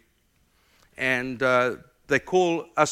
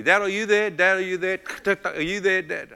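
imitated daughter, higher and louder down the line; calling out, concerned; 6 semitones higher, 6 dB louder than usual. Daughter to her weeping dad on the phone, calling out. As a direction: urgently check that he's still on the phone.

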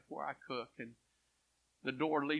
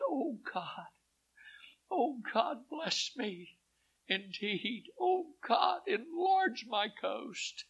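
pleading prayer; 8 semitones higher, much slower than usual. Retold or imitated. Preacher voices Jabez's prayer.

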